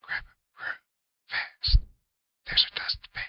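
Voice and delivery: hurried whispered orders. A narrator's reading. Uncle urging the boy to hide the mess, whispering. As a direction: whisper the instructions quickly.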